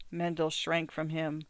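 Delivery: ordinary delivery, nothing marked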